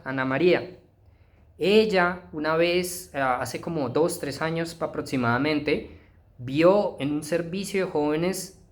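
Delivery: ordinary delivery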